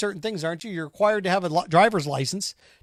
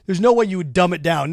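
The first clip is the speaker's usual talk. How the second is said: ordinary talk, nothing marked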